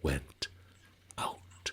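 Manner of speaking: whispered, fading to nothing; whispered ending. A narrator's reading. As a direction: breathe the last words out. Story's hushed ending.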